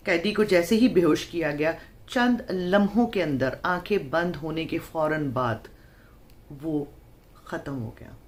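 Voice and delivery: usual speaking voice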